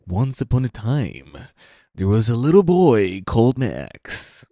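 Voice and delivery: put-on fairy-tale narrator voice; storytelling voice. Shown as a demonstration. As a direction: demo a story mode, as if opening a bedtime tale. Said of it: "if I make voices or actually talk like this".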